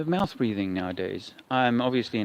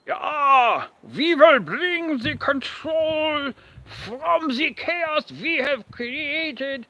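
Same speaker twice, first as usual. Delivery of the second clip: slow, mock-sinister German accent, rising much higher at the end; hammed-up German accent, dragging out each word; German-sounding villain; 11 semitones higher, 4 dB louder, much slower than usual. Vlogger on stream playing a sinister schemer, slow mock German accent. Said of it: "I went into a German sounding thing there".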